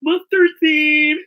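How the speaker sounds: high, loud, slow fairy reply. Acted. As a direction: announce the name like a tiny fairy. Fairy names herself.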